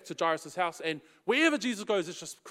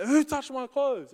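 mild retold yell; 6 semitones higher than usual. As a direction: call out the question to the crowd. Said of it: "he yells out".

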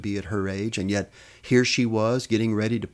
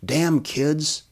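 Mary hollers at unseen pranksters, mild holler; raised, higher-pitched annoyed call; 6 semitones higher, 4 dB louder than usual. A narrator's reading. Narrated holler, not full force. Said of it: "she hollered".

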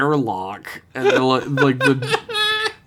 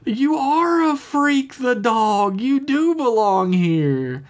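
high, slow, drawn-out mock voice; hammed-up tender proclamation; emotional Tendi; 13 semitones higher, slower than usual. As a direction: declare it high and slowly, hamming up the sentiment. Tendi's tearful affirmation to the dog.

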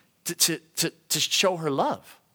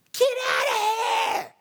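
drawn-out, high-pitched screamed order; acted scream; slower than usual. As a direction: scream it shrilly, stretching the words out. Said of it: "You can start screaming".

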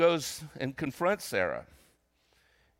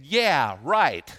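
loud, scoffing "yeah, right"; mock incredulity in everyday vernacular; cynical disbelief; 4 semitones higher, 7 dB louder, slower than usual. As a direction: imitate a scornful doubter, punched out loud. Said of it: "her laughter was a cynical laughter".

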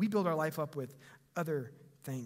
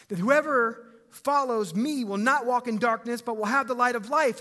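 proclaiming Jesus; 6 semitones higher, 8 dB louder than usual. Acted.

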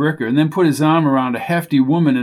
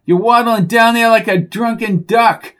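loud, higher frustrated shout; exasperated yell; 8 semitones higher, 5 dB louder than usual. A narrator's reading. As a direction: yell at a losing horse. Yelling at slow horse.

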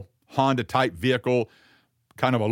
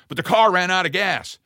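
louder, higher, distressed plea; a stranded young man pleading, voice raised; agitated; 7 semitones higher, 5 dB louder than usual. Retold or imitated. Stranded young man tells the helper, agitated and raised. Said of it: "He's, he's really agitated".

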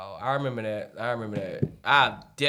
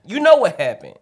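loud sharp voice; 5 semitones higher, 10 dB louder than usual. Real, not acted. Heated argument about yelling.

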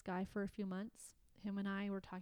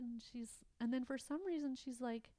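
ordinary delivery

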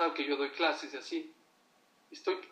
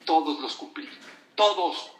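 insistent; 10 dB louder than usual. Retold or imitated. Old rabbi insists he met all goals.

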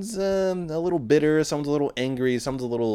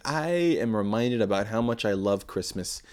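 usual speaking voice

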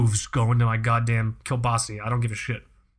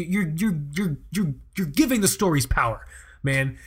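usual speaking voice

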